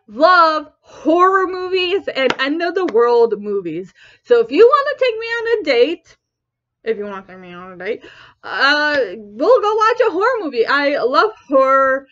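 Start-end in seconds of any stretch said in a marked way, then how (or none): 4.2-6.2 high, lifted date invitation; raised invitation. Real, not acted. Date invitation, first take raised. 6.8-8.0 low, quick, soft repeat; hushed throwaway aside, low and rapid; muttered aside. Real, not acted. Same words as muttered aside.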